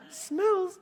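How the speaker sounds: loud, very high one-word question; husband's astonished yelp; incredulous echo. Retold or imitated. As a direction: act out disbelief for laughs. Husband astonished by wife's claim.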